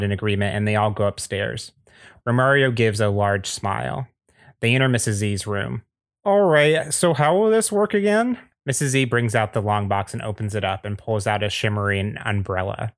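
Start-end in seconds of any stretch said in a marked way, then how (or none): 6.2-8.4 character voice. Acted. Character voice in rpg scene.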